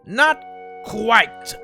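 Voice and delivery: normal talking voice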